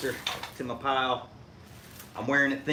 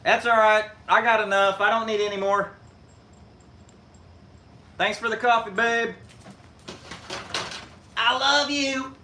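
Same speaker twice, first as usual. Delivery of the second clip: calling out; 9 semitones higher, 4 dB louder than usual. Real, not acted. Calling to wife upstairs.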